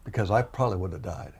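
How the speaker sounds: ordinary talk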